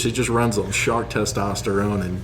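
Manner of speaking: ordinary talk, nothing marked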